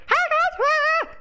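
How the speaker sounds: very high, loud squeaky squeal; Super. Acted. Streamer imitating a player screaming in comms, squeaky voice. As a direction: squeal it like Tickle Me Elmo. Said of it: "in his little high-pitched squeaky voice".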